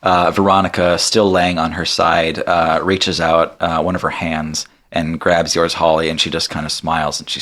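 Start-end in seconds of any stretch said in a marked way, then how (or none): none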